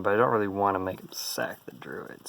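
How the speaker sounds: normal talking voice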